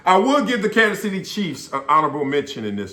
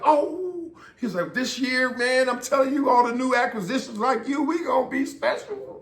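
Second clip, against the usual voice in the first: hyped-up fan; 11 semitones higher, 3 dB quieter than usual. Retold or imitated. Fan hyping the new season.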